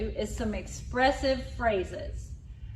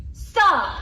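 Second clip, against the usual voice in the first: higher, louder annoyed "Stop"; frustrated stop; 7 semitones higher, 8 dB louder than usual. Shown as a demonstration. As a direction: snap "Stop" at the tease. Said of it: "Do it again".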